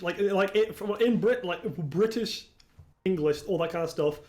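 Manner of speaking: ordinary talk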